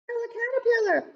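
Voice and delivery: high, quick call of the name; cried out. Acted. Lucy calling to the passing caterpillar, high and eager. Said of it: "cried Lucy".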